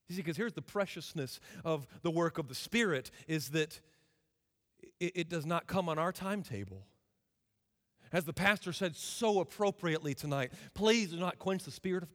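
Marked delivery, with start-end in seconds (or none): none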